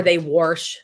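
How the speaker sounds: "wash" with an added R, "warsh"; local-style "wash" said as warsh; regional pronunciation. Retold or imitated. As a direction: put an R into "wash". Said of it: "That's not how it's spelled".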